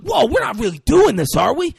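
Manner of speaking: high, loud clueless protest; oblivious disbelief. Retold or imitated. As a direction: act out naive shock, voice jumping up. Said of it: "Just oblivious".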